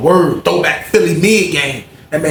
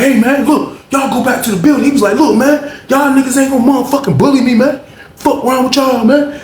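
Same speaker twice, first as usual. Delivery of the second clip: officer telling inmates off; mock CO yelling; 10 semitones higher than usual. CO shouting orders at inmates.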